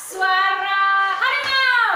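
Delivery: slow, loud sing-song call; playful prompt. Real, not acted. Teacher prompts tiger sound playfully.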